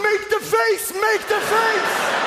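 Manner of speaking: high, frantic yelled command; frantic inner command. Pretend. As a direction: urgently yell at yourself to make the face. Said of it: "the half is going".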